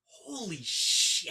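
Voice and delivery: long, drawn-out stunned swearing; stunned reaction. Acted. Podcaster acting out their reaction right now, stunned. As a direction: drag it out in slow disbelief. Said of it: "this is my reaction right now".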